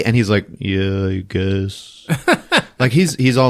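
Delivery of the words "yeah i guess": slow, emotionless mumbled reply; blah, no emotion. Retold or imitated. Dazed husband answering his wife, blah and emotionless. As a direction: imitate someone completely vacant, dragging it out. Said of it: "kinda blah, like, no emotions".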